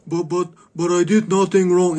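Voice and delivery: high frantic stammer; panicked driver. Retold or imitated. Mocking the driver caught at gunpoint, high panicked stammer. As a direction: squeal it like a startled coward. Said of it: "I pull a gas pistol".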